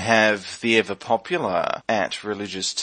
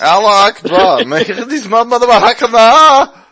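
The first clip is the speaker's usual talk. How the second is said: put-on shouted, slow Middle Eastern accent; 11 semitones higher, 14 dB louder than usual. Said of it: "this has to be racist".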